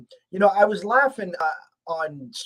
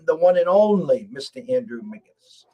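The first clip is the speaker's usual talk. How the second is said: louder, "only" leaned on like an announcer; grand guest introduction; 6 dB louder than usual. Shown as a demonstration. Host bringing on Andrew McGinnis, louder announcer flourish. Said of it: "let's bring him on".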